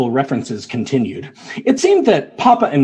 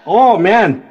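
put-on upbeat North Dakota accent, raised higher; folksy Midwestern local, "ain't no fun"; 4 semitones higher than usual. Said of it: "North Dakota accent".

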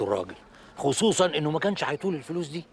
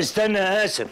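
raised, urgent call after him; 8 semitones higher, 5 dB louder than usual. Acted. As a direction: shout to stop him before he leaves. Father calls after leaving son. (نداء عالٍ وملهوف)

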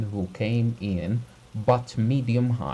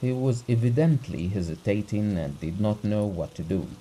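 plain reading voice

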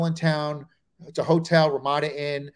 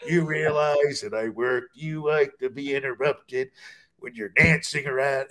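Nicholson; 2 semitones lower than usual. Acted. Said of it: "like, Nicholson".

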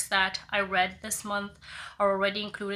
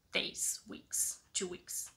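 whispered self-correction; whispered aside; 10 dB quieter, slower than usual. Real, not acted. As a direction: whisper the correction under your breath.

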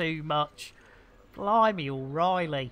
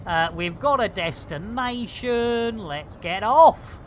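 normal talking voice

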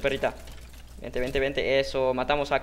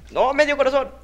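much higher; alarmed squeal; 11 semitones higher, 6 dB louder than usual. Real, not acted. Gamer down to half a heart, alarmed squeal.

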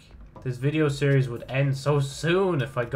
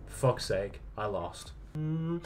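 normal talking voice

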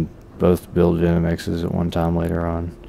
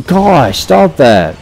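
sudden high yelp; real jolt of fright, loud; startled shout; 8 semitones higher, 7 dB louder, much slower than usual. Real, not acted. Startled by a scare.

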